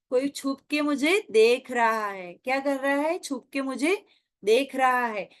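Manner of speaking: normal talking voice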